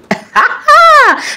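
squealing giggle, pleased with herself; high delighted laugh. Real, not acted. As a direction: let out a high happy laugh. Admiring herself after facial.

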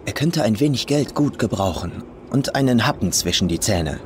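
ordinary delivery, nothing marked

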